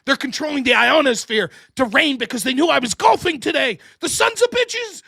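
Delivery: hammed-up rage at the weather; mock conspiracy rant. Imitates ranting conspiracy theorist.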